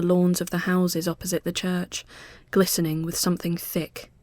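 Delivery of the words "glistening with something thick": ordinary delivery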